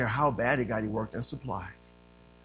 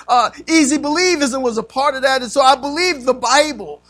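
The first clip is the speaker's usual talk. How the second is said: loud, raised preaching voice; forceful preaching; 10 semitones higher, 14 dB louder than usual. Real, not acted. Preacher telling church of his search for God, forceful preaching.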